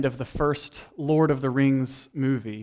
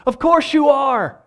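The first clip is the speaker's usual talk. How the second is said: hammed-up Sam with a laugh; dramatic Sam; 12 semitones higher, 11 dB louder than usual. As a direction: belt out loyal defiance. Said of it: "and he says".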